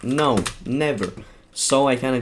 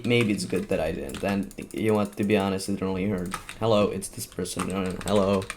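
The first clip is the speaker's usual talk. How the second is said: ordinary delivery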